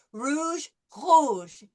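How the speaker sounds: rouge with the r from the back of the throat; throaty r. Shown as a demonstration. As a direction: say rouge with a uvular r. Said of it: "comes from the back of the throat".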